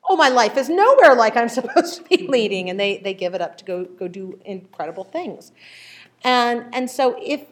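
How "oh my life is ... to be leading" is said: excited realization. Retold or imitated. Retold realization on reading Jesus.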